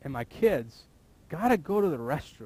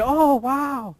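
put-on child's voice; 13 semitones higher, 6 dB louder than usual. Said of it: "Let your little ones just say".

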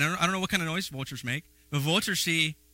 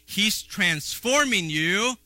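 slow and higher, each word drawn out; slow emphasis; 6 semitones higher, 4 dB louder, much slower than usual. Shown as a demonstration. Preacher assuring the church God is changing them, slow and raised. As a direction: lift and stretch transforming.

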